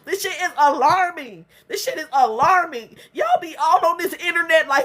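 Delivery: normal talking voice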